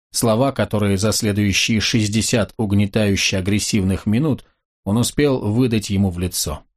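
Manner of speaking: ordinary delivery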